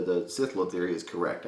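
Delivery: ordinary delivery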